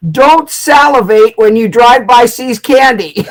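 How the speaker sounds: loud, slow, looming command; booming hypnotist command. Retold or imitated. Hypnotist on the earphones, booming slow command. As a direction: intone it like an oracle. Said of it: "what he said in the earphones".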